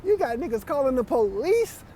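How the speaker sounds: high, alarmed questioning of a friend; mock horrified friend asking what's wrong; incredulous disbelief. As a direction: confront him in high-pitched shock. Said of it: "I'm like".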